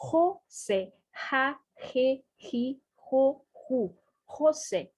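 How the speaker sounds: "José" with J as a raspy Spanish h; Spanish J sound. Shown as a demonstration. Teacher demonstrating the letter J, "José" with Spanish h sound. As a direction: start "José" and each syllable on a throaty h, separately. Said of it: "Olha como eu pronuncio o J".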